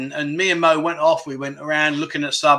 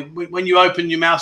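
ordinary talk, nothing marked

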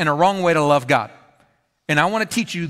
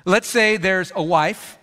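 ordinary talk

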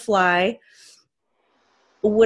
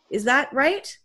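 normal talking voice

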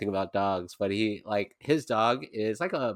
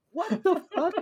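much higher, stunned outburst; shocked disbelief. Retold or imitated. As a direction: blurt it out in total disbelief. Narrator's shocked reaction retold.

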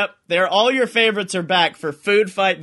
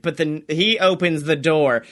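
normal talking voice

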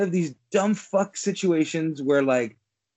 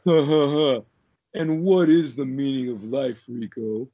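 a lightly voiced sneering warden, ha ha ha; mocking warden laugh; 4 semitones lower than usual. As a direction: sneer it out like a smug villain. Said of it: "And the guy's like".

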